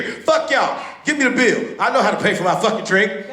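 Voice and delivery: high-pitched indignant customer rant; worked-up mock outrage; getting loud. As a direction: act out an insulted customer demanding the bill. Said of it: "You gotta get loud with people".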